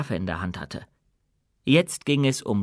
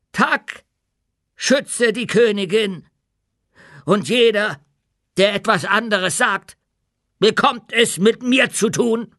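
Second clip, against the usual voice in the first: put-on higher, louder, defiant old dwarf cry; shouting; 9 semitones higher, 6 dB louder than usual. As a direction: call it out to the whole hall. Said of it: "dann rief er".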